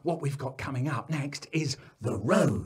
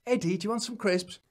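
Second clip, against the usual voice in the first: higher, quick coaxing question; coaxing parent; 8 semitones higher, faster than usual. Acted. Parent offering picnic food to little Eddie, coaxing. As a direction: keep tempting a small child.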